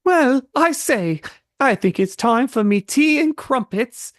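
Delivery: put-on prim British accent, royal and proper; British Deku. Deku playing the Queen of England, British accent. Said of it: "Deku, but British".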